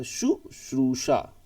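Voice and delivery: शुश्रूषा stretched slowly, shu-shru-sha, each sh clear; slow, careful spelling-out. Shown as a demonstration. Teacher dictating शुश्रूषा slowly to the class. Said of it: "शुश्रूषा में दोनों से आ जाएगा".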